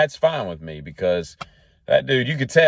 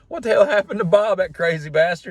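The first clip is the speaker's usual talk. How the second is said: amused disbelief; 11 semitones higher than usual. Retold or imitated. Barbershop regular hearing Bob's news, high and amused. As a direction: cackle the words out in disbelief. Barbershop guy reacting to Bob.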